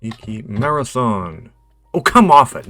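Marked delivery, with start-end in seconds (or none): 1.9-2.7 loud, higher burst of disbelief; real indignant outburst; exasperated protest. Real, not acted. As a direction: protest loudly at a refused answer. Protests a rejected quiz answer.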